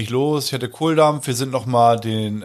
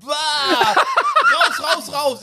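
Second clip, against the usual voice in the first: shocked outcry; 13 semitones higher, much slower than usual. Retold or imitated. Walking into the men's toilet, shrieking in disgust to get out.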